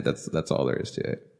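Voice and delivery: unvoiced trailing whisper; whispered aside. Real, not acted. Summing up the anime to a friend, whispered. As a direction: let it fall away to a breathy whisper.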